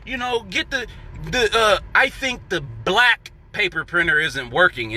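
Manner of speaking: a loose, offhand imitation of the coworker; casual mock coworker voice. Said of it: "and they laugh".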